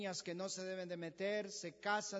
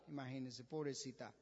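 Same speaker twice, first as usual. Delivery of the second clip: much lower and softer, pitying; pitying aside; 8 semitones lower, 6 dB quieter, faster than usual. Real, not acted. Preacher pities the beaten secretary, low and soft. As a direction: drop the voice in sympathy, pobrecita.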